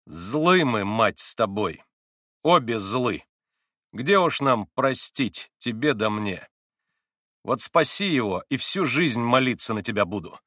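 loud, bitter, poisoned retort; venomous spite. A narrator's reading. Grushenka refuses Katya forgiveness, venomously. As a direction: throw it back with malice. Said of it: "ядовитым, отравленным злобой голосом ответила".

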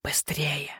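very soft urgent hiss; hissed whisper. A narrator's reading. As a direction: hiss it right into someone's ear. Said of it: "прошипел король Веренс в ухо матушке". (еле слышное шипение на ухо)

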